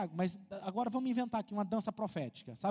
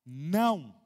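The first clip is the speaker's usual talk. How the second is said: a loud, low, firm "Não."; a flat, weighty "Não." that closes the matter; firm no with intonation; 6 semitones lower, 5 dB louder than usual. Shown as a demonstration. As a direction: say "Não." as a loud, final refusal. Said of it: "você viu que tem uma entonação".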